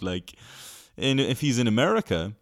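ordinary talk, nothing marked

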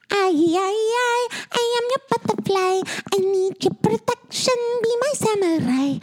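squeaky sing-song impression; high singer impression. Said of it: "really good audio impression".

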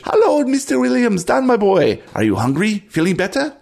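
put-on high, loud hail to an old pal; hearty camp cook. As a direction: greet him in a high, friendly character voice. Game master greets as Cajo.

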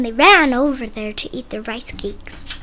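ordinary delivery, nothing marked

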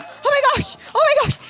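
very high, gasped-out mock alarm; re-enacted shock. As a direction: react as if just drenched in ice water. Acting shock at ice water.

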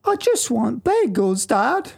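put-on high, slow whiny kid voice; high childish whine. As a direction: beg your dad like a little kid. Skit: child begging father.

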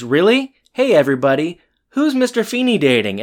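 high, loud mock announcement to the class; teasing call-out. Eric teasing Feeney in front of the class, high and loud.

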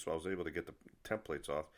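ordinary delivery

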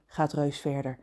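ordinary delivery

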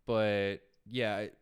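ordinary talk

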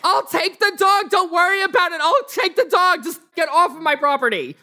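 high-pitched homeowner's shout; exasperated woman, shouting high; exasperated yelling. Acted. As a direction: yell to get rid of the kids. Woman angrily shooing kids away.

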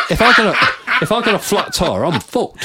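hammed-up panic through giggles; retelling a friend's panicked rant, laughing; laughing mock panic.

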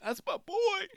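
high cracking voice of a proud dad; a hint of a proud father choking up; imitating Tony's voice catching. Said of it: "you could hear it in his voice, kinda crackled".

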